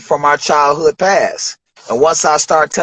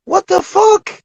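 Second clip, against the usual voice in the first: stunned disbelief; 8 semitones higher, slower than usual. Retold or imitated. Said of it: "I'm like".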